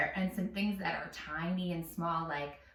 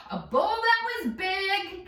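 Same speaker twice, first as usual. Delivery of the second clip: loud; 11 semitones higher, 4 dB louder, slower than usual. Shown as a demonstration. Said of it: "give me forte".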